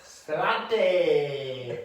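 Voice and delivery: a loud, ringing toast. Pretend. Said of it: "just a little bit louder".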